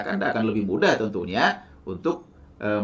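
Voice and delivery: ordinary talk, nothing marked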